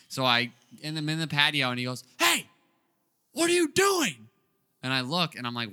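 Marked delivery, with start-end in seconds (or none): 2.2-4.3 high, loud confrontational yell; retold screaming. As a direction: holler like an angry store owner. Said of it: "He's literally screaming at me".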